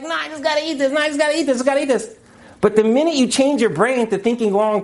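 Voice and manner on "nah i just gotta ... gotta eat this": lightly voiced greedy craving, higher. Lecturer voices the craving brain to students, high and whiny. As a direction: mimic the brain begging for food.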